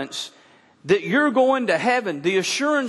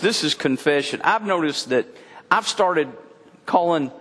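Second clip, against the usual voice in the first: ordinary talk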